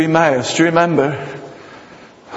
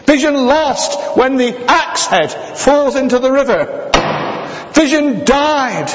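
preaching at full force; 9 semitones higher than usual. Acted. Preacher to congregation about lost vision, fervent preaching. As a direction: raise the pitch and preach it out, slow on vision.

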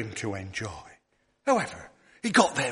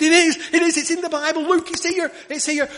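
put-on high, loud insisting boy; thrilled boy pointing at the page; excited child; 12 semitones higher, 9 dB louder than usual. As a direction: plead eagerly like a small child. Boy insists name is there.

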